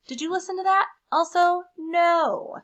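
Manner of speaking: high, loud, fed-up complaining; exasperated crayon. Acted. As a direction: scold Duncan like a fed-up crayon. Crayon letter read in character.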